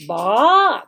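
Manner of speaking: ba sliding upward; rising tone. Shown as a demonstration. 升调 ba. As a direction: lift ba like a question. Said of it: "They have a rising sound".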